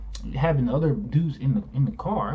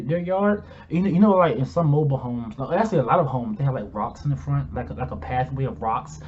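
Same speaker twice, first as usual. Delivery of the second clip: ordinary talk, nothing marked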